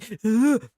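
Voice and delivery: sharp, high gasp of horror; loud startled gasp; shocked gasp. Acted. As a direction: suck in air in pure shock. TJ hears I love you.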